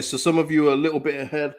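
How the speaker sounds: usual speaking voice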